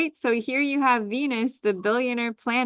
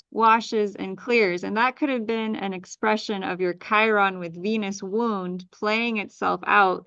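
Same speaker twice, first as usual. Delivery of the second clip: ordinary talk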